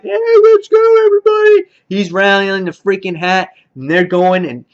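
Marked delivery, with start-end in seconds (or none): 0.0-1.6 much higher, louder put-on voice calling out; rally the troops in a silly high voice. Fan mimics the little guy rallying everybody, high and loud. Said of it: "The little guy's rallying".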